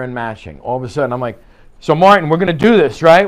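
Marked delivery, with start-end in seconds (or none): 1.8-3.3 loud, higher pushy pitch; overbearing approach. Shown as a demonstration. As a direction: come on strong, too loud for the room. Standing over client, mismatched approach demo.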